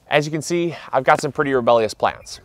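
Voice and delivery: ordinary talk, nothing marked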